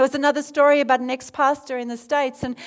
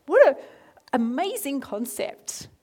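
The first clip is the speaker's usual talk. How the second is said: marvelling to oneself, leaping up in pitch then slowing; acted-out astonishment at an idea; retold amazed thought; 2 semitones higher than usual. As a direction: act out wondering disbelief, ending on a questioning rise. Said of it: "I thought to myself".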